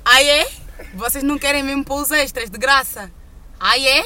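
mock shouting manager. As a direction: belt out the manager's "ah, é?". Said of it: "ele assim". (gerente a gritar, imitado)

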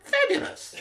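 extremely high squeaky creature voice; delighted creature. Acted. Creature pleased at the promise of meat, delighted squeal.

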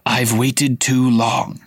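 slow, unvoiced menacing hiss; escaped prisoner hissing at Harry; hissed whisper. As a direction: breathe it out slowly like a threat. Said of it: "he hissed".

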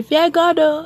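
high, scolding grandma voice; grandma's warning. Retold or imitated. Grandma scolding a child over small things, high and stern. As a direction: wag a finger like a Nigerian grandma. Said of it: "she would say".

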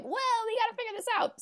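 very high mock-panicked character voice; scared cartoon duo. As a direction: squeak it out like a frightened cartoon sidekick. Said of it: "shitting their pants in this ride".

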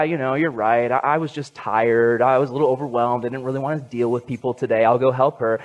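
low, offhand imagined admission; weary concession. Acted. Imagines tired Jesus giving in.

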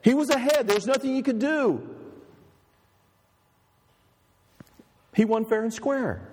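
a hint of a young woman consoling her man; voicing Sybil.

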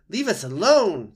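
high, very loud frightened squeal; squeaking weasel. A narrator's reading. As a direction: squeak it out to drive the intruder away. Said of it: "squeaked Dart as he crouched low".

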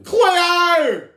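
high, loud, drawn-out call; hammed-up high shout; mock calling out. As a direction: yell the name like a TV heartthrob in water. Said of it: "impression of every guy from H two O".

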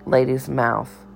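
ordinary delivery, nothing marked

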